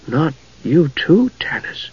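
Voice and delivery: slow, soft, fading dismay; small voice. A narrator's reading. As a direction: shrink it down, trailing off to nearly nothing. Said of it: "said in a small voice".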